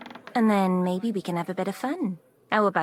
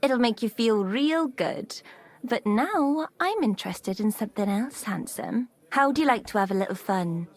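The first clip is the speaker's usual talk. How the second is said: flirtatious; 6 semitones higher than usual. Acted.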